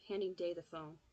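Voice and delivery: ordinary delivery, nothing marked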